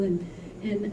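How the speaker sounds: ordinary delivery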